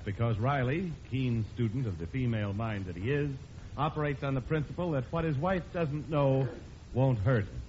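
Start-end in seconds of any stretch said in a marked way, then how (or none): none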